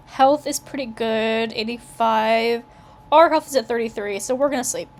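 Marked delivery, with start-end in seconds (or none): none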